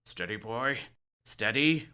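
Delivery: ordinary delivery, nothing marked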